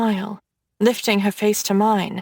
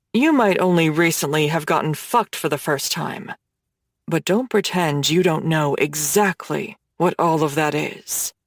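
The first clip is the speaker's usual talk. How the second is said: Hades, low voice; 7 semitones lower than usual. Acted. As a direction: tease her, low and confident like a dark god.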